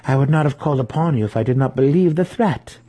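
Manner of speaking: stung matron defending her sources; defensive. A narrator's reading. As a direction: push back on the accusation. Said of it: "Malice replied defensively".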